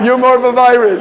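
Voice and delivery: high, loud laughing jab; laughing tease. Real, not acted. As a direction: tease them while still laughing. Teasing reply amid laughter.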